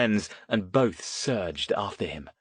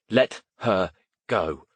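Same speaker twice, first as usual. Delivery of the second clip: firm; slower than usual. A narrator's reading. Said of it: "Alistair said".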